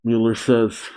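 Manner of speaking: usual speaking voice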